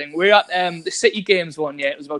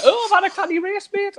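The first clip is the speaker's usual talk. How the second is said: mock overwhelmed athlete; 14 semitones higher than usual. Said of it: "and you're like".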